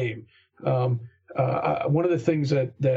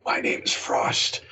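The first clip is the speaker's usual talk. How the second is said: slow, hoarse in-character whisper; slower than usual. Acted. Hanged paladin introduces herself to the party, whispering. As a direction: whisper it slowly, like a revenant. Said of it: "she's whispering".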